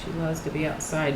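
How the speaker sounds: normal talking voice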